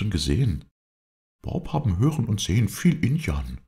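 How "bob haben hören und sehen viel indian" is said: high, lively character voice; Bob, eager helper. A narrator's reading. Bob tells his master how he found the tree, high and lively. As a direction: explain excitedly in a higher pitch.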